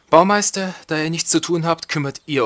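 calling out. Pretend. Calling out to the builders.

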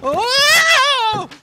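sudden high startled yelp; startled cry. Acted. Actor alone in the dark corridor, a high startled yelp. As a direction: jump out of your skin.

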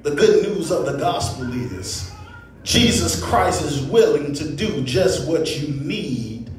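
usual speaking voice

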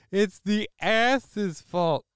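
high, slow mock voice; goofy voice. Streamer mocking the chat in a goofy slow voice. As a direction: drag out a silly cartoon voice.

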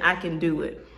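ordinary delivery

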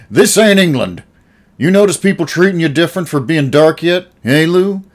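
loud, high, furious nose-to-nose challenge; snarl. A narrator's reading. Old Al snarling at Uncle Lou, furious. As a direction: get right in his face. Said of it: "he snarled at Lou".

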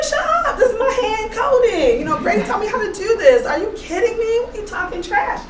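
high-pitched, playful defensive protest; mock-indignant comeback. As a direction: fire back in a high, indignant voice, half laughing. Acted comeback to a teasing friend.

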